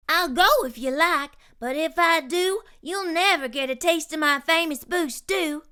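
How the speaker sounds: lightly voiced plucky cook; Curly Locks voice. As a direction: stand your ground, sweetly stubborn.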